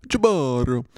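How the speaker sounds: hammed-up Italian pizzeria cry; mock Italian accent. Host reprising the Jaboro guy, mock Italian accent. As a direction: call it out in cartoon Italian.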